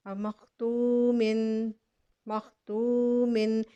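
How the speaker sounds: मख्तूमिन drawn out slowly with the final "in"; slow recitation. Shown as a demonstration. Teacher sounds out the joined form, slow and clear. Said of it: "मीम के नीचे दो ज़ेर है".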